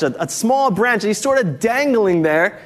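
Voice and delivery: usual speaking voice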